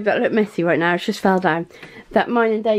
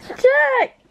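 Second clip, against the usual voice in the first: high call across the house. Real, not acted. Calling son again.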